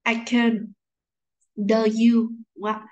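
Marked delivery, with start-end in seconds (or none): 0.1-0.7 "I can" with "can" as "kən"; weak form. Shown as a demonstration. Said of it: "chúng ta sẽ đọc là gì". 1.6-2.3 "do you" with "do" as "də"; weak form. Shown as a demonstration. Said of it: "đọc dạng yếu của nó".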